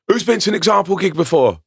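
re-enacted, lightly projected crowd question; calling to crowd. Retelling question called to crowd.

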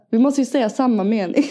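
ordinary talk

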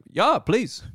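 put-on comic German accent, slowing at the end; mock German accent. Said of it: "the Dutch accent as a German guy".

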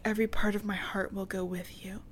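breathy, unvoiced mock-tender whisper; mock romantic whisper. Podcaster mocking Sarah's goodbye to John, whispered. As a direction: hush it like a cheesy love line.

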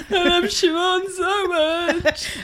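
high, drawn-out tearful wail through laughter; mock drunken crying. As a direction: pretend to blub with drunken affection. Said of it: "I'll be crying by seven P M".

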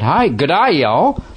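high, hammed-up English and Southern mix; English-Southern blend. Shown as a demonstration. Demonstrating the accent mix on Australian radio, hammed-up. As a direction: blend a posh greeting into a Southern y'all. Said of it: "You get".

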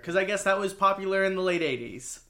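ordinary talk, nothing marked

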